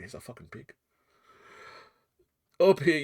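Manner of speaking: ordinary delivery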